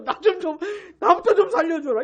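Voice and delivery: hammed-up panicky cry for help; mock-frightened plea. Imagining a scared Jesus asking fisherman Peter to save him, mock-frightened. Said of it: "무서워하시면서".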